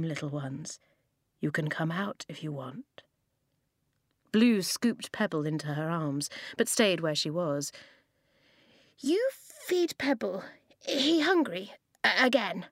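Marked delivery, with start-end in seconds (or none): 0.0-3.0 much softer, lower, whispered; gentle coaxing whisper. Acted. Old man coaxes scared children. 9.0-12.7 put-on a small child pleading for her pet; little girl asking. Small child asks for fox food.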